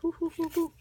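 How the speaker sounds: high, giddy whooping; excited whoo. Real, not acted. Vlogger whooping as cards get flipped.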